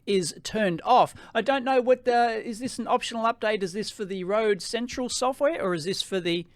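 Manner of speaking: ordinary talk, nothing marked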